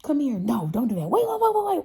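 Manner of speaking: high, loud playful calling out; high-pitched, loud excited banter; lively loud family talk. Retold or imitated. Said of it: "but we laughing loud".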